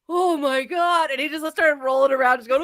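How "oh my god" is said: high, slow mock astonishment; imitated overwhelmed reaction. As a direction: play a man utterly blown away. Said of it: "screams, and all of a sudden he".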